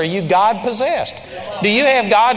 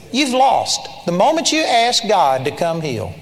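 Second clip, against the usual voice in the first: usual speaking voice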